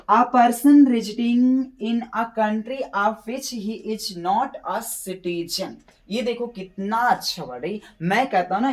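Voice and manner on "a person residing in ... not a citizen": very slow, each word spaced out; slow dictation. Shown as a demonstration. Teacher reading the definition slowly to students, word by word. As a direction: read it like dictation to a class. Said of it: "teacher reads sentence aloud".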